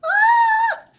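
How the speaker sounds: very high, loud shriek; short play-acted scream; mock scream. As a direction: pretend fright at a dragon. Mock fright at the dragon.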